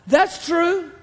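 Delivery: a hint of the woman. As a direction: agree with humble cheer. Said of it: "She replies".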